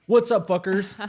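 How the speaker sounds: hype announcer. Acted. Mock-hype greeting to the audience.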